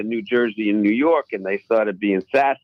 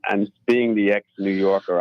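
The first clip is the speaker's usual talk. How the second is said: usual speaking voice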